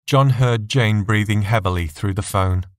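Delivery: plain reading voice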